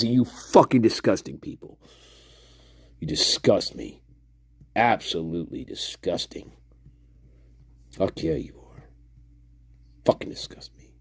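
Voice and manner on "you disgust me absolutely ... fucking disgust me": low, soft contempt, fading to a whisper; muttered disgust. Real, not acted. Streamer telling people they are disgusting, muttered disgust. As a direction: trail down into quiet revulsion.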